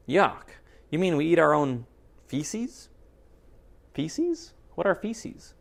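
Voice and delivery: squeaky rise of disgust on feces; lightly voiced bunny kids.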